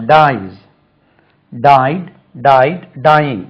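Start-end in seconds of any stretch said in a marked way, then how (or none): 0.0-0.5 Dies said loud as "daiz"; final Z sound. Shown as a demonstration. Teacher repeating the s form, loud Dies with a z ending. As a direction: hit Dies firmly with a z ending. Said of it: "ஜெட் உச்சரிப்பு".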